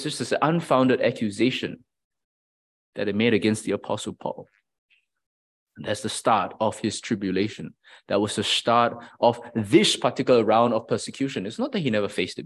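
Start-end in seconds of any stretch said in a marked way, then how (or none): none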